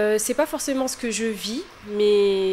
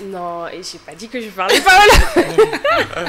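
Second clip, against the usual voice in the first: louder protest broken by laughter; amused, louder denial; laughing protest; 10 dB louder than usual. Real, not acted. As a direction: laugh it off while objecting. Teasing about speaking Wolof.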